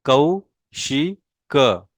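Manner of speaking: कौशिक stretched out slowly, the औ held; slow model pronunciation. Shown as a demonstration. Teacher models कौशिक slowly for the class. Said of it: "मेरे pronunciation के ऊपर आपने ध्यान देना है".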